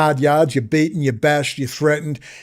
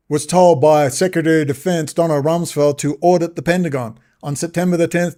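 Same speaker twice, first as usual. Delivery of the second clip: ordinary talk, nothing marked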